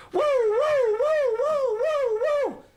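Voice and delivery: high, loud wailing police siren noise; siren imitation. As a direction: wail like a cop car siren.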